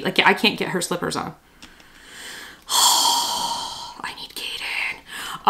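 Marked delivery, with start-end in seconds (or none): 2.7-5.5 breathy defeated whisper under a sigh. Real, not acted. Beaten by the tiny slippers, whispering for her son. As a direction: give up and whisper it out.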